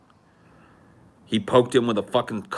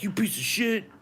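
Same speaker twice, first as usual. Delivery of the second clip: insult; 10 semitones higher than usual. Retold or imitated. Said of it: "He's like".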